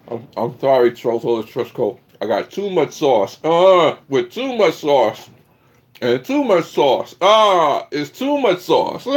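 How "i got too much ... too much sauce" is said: hyped catchphrase. Acted. Catchphrase chanted higher, laughing.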